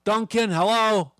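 high, hesitant order call; barista calling an order. Retold or imitated. Barista calling the Aussie's coffee order, high hesitant call. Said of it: "They said".

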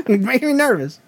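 high-pitched laughing protest; laughing, flustered. Real, not acted. Host telling watching co-host to shut up, laughing nervously. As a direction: laugh it off, embarrassed.

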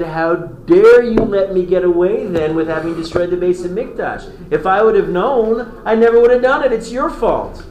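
acted fury blaming the advisors; raging king. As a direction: rail at them like an outraged tyrant. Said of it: "he flies into a rage".

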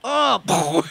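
mock confused animal; dithering deer. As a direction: voice the deer freezing.

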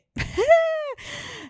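high-pitched giggle; high laugh. Real, not acted. Host alone after admitting she jumbled things, high laugh.

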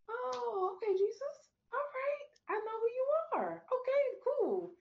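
imitating her own amazed reply to Jesus; sudden realization. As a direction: squeal it like the penny just dropped. Acting her relieved reply to Jesus.

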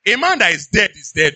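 normal talking voice

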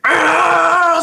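piercing high squeal; high device shriek. Retold or imitated. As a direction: shriek like the meter again. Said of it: "and then it".

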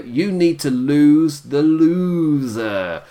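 slow drawn-out smug delivery; mock smug manager. Manager luring a wrestler away, slow and smug. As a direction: lean on each word like a smarmy manager. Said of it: "says she's got all the potential".

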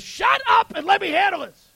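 mock henpecked husband losing patience; exasperated husband. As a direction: cut her off, voice jumping right up. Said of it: "The husband says".

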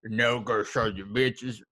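slow drawl with pushed-out lip; lip stuck out. Acted. Co-host mimicking the actor's lip talk, slow thick-lipped drawl. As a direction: talk slowly through a jutting lip. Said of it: "his lip was all the way out".

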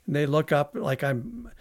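ordinary delivery

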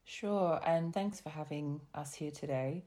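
normal talking voice

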